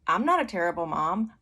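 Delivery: firm, matter-of-fact denial; settled, unapologetic mother; unaffected. Retold or imitated. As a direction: state it evenly after a pause, untroubled. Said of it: "it didn't affect me".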